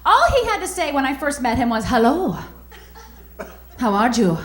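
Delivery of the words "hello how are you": put-on mild Latin accent greeting; a hint of a smooth Latin accent; Latin lover accent. Ex-boyfriend greeting her in a light Latin accent. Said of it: "that accent".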